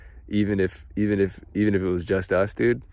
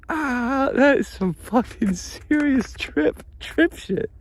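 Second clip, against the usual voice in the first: overwhelmed; slower than usual. Real, not acted. Overwhelmed, crying high.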